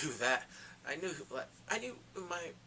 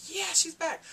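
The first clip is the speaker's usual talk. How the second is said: high, loud gleeful cheer; thrilled fan yelling yeah she's back; excited cheer; 13 semitones higher, 8 dB louder, slower than usual. Retold or imitated. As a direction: squeal it like a fan at her return. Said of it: "finally, I'm like".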